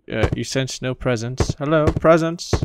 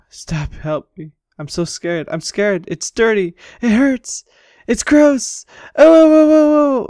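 building terror; 9 semitones higher, 6 dB louder than usual. Acted. Trapped girl begging to be let out, panic building to screams. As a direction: panic rising line by line until screaming. Voicing trapped scared character.

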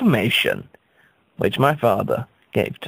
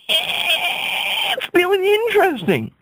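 drawn-out high; much slower than usual. Acted. Repeated phrase, high drawn out.

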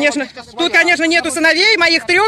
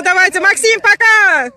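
calling out; 5 semitones higher, 6 dB louder than usual. Real, not acted. Calling goodbye to departing boat.

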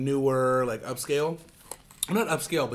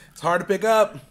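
laughing loudly while fumbling; 6 semitones higher, 8 dB louder than usual. Real, not acted. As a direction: burst out laughing mid-line. Struggling to lift messy sandwich.

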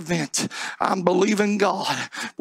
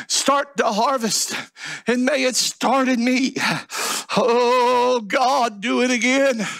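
pleading pulpit prayer, building in force; fervent prayer; 5 semitones higher than usual. Real, not acted. Preacher asking God for a harvest and holiness, earnest prayer.

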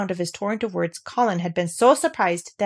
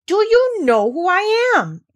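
much higher, louder imperious demand, slow; more imperious; 11 semitones higher, 5 dB louder, slower than usual. Acted. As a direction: throw your whole royal weight behind it. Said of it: "demanded Colin still more imperiously".

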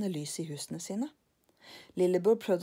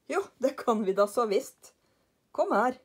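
louder, cocksure insistence; insisting Karlsson; 5 semitones higher, 5 dB louder than usual. Acted. Karlsson insists to lillebror, louder. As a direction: wave away the worry.

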